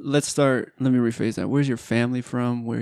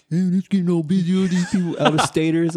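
higher, faster grumbling imitation; mock complaining coworker; 6 semitones higher, faster than usual. Coworkers griping about out-of-staters, quick whiny mock voice. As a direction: whine about the crowds, hammed up. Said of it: "they're like".

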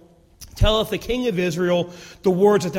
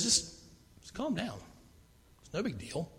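very soft, almost whispered calm; calm hushed reassurance; 15 dB quieter than usual. Acted. Elisha hushing his panicked servant, soft and calm. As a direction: soothe a panicked servant quietly.

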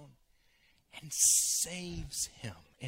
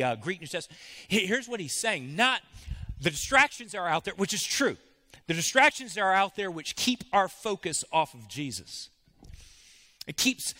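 loud, high, driving sermon delivery; preaching at full force; 5 semitones higher, 10 dB louder than usual. Acted. Pastor preaching to the congregation on Peter's doubt, loud and driving. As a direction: hammer each point home to the pews.

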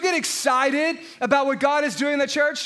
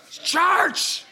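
"It's church" higher, with "church" drawn out as "charch"; solemn churchy voice; 4 semitones higher than usual. Shown as a demonstration. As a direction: intone "It's church" like a stern old deacon. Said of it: "You gotta say it like that".

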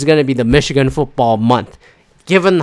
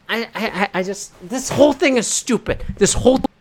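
passionate rant; 5 semitones higher, 4 dB quieter than usual. Real, not acted. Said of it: "'cause I got so passionate".

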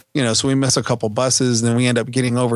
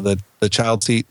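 usual speaking voice

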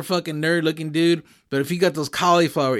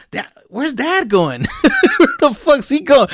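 put-on kid voice; 9 semitones higher, 4 dB louder than usual. Kids voiced, laughing.

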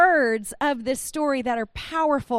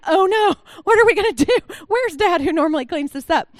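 mock panic; 3 semitones higher, 6 dB louder than usual. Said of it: "like, an".

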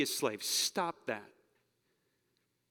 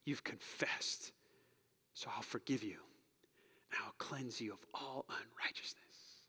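slow, hushed whisper, tender and firm; the Father's voice; 6 semitones lower, 11 dB quieter than usual. Acted. Pastor voicing God to the congregation, whispered and tender. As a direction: breathe it gently like a loving father calling his child home. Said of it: "I hope you hear the Father's voice".

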